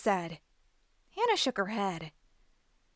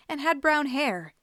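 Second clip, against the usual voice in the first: plain reading voice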